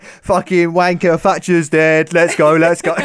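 loud, high, slow mock lad chant; rowdy fan with a pint, hammed up; lairy football fan. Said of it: "you wear, like, an Arsenal shirt".

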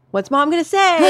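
high, loud parental prompt; quizzing call. Retold or imitated. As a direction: prompt the kids for their routine. Mom quizzes kids at door.

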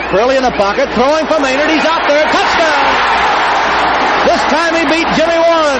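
excited touchdown call; live play-by-play. Real, not acted. Radio announcer calling the touchdown. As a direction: build to the touchdown.

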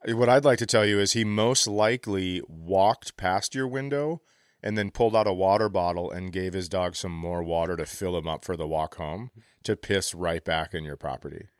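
usual speaking voice

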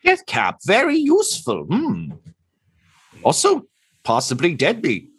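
high, musing avian voice; bird doctor. Acted. Bird doctor considers Tortle's name, high musing creature voice.

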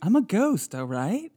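high squeak falling to plain; a silly ghost announcing itself; mock ghost. Joke option, the ghost introduces itself. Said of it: "Or D".

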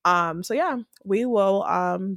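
usual speaking voice

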